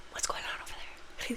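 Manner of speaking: hushed whisper to a tablemate; nosy whisper. Retold or imitated. Diner at the next table whispering about the scene, nosy hush. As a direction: lean in and whisper the gossip. Imagined restaurant diner gossiping.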